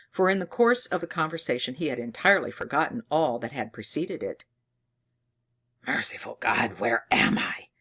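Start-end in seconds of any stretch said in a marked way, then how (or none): 5.9-7.7 in agony. A narrator's reading. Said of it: "exclaimed he in agony".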